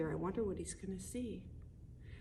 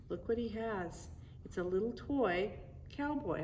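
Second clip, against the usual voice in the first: ordinary delivery, nothing marked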